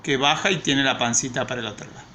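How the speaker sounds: usual speaking voice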